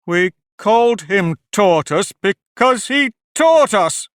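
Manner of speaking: slow, loud, high angry retort; angrily. A narrator's reading. Mock Turtle scolding Alice, angry and loud. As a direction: snap back, offended. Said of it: "said the Mock Turtle angrily".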